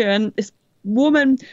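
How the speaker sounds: normal talking voice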